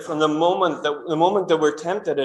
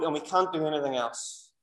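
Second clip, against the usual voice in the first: normal talking voice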